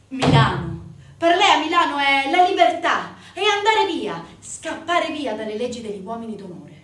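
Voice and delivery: loud, high and fervent, Milano as freedom itself; swelling passionate declaration that fades at the end; impassioned outburst. A narrator's reading. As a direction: proclaim it like an escape cry. Narrator bursts out about freedom.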